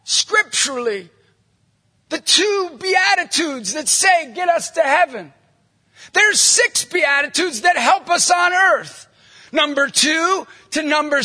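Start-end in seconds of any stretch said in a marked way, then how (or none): 2.1-9.1 preaching shout. Real, not acted. Preacher proclaiming to the crowd.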